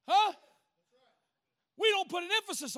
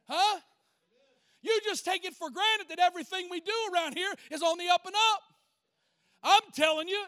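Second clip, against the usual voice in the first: raised, insistent pulpit voice; impassioned preaching; 6 semitones higher, 5 dB louder than usual. Real, not acted. Preacher challenging churchgoers on what they believe, impassioned preaching. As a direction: press the challenge hard on the congregation.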